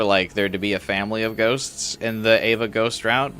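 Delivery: ordinary talk, nothing marked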